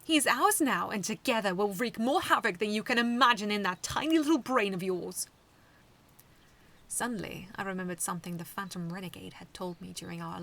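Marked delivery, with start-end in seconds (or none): 0.0-5.3 sneering. A narrator's reading. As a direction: gloat with contempt. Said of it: "he sneered".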